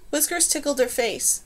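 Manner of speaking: plain reading voice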